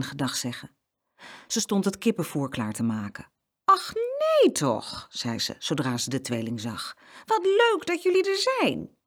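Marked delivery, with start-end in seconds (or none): 3.6-5.1 high, long-drawn exclamation of surprise; delighted surprise. A narrator's reading. Farmer's wife spotting the twins, delighted surprise. 7.2-9.0 high-pitched, gushing welcome; delighted welcome. A narrator's reading. Farmer's wife welcoming the visiting twins, delighted.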